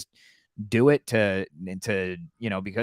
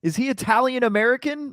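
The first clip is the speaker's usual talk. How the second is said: mock question; 11 semitones higher than usual. Real, not acted. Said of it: "mocking question".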